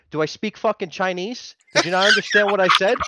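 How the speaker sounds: retelling a roadside argument, half-acted; mock angry retort. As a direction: snap back at him in mock outrage.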